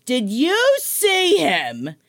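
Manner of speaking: slow, drawn-out mock indignation; imitating mad mom. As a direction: act out an incredulous mother, spacing every word. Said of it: "Why are you so mad?".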